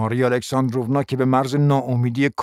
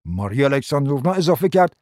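ordinary delivery

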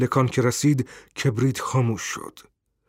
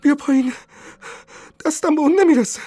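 very high, strained yell for help; crying out; 4 dB louder, faster than usual. A narrator's reading. Wife at the bolted door calls husband, crying out. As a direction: shout it shrilly, climbing higher and louder. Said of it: "زن فریاد زد".

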